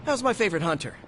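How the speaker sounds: ordinary talk, nothing marked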